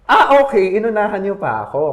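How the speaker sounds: high, loud mock-surprised "ah, okay"; sarcastic comeback. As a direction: toss it back with a sarcastic sting.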